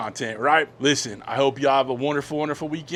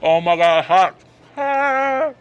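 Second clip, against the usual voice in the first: louder, gasping from scalding food; burnt mouth; 5 semitones higher, 7 dB louder, slower than usual. Real, not acted. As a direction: react to a burning hot bite. Food burns his mouth.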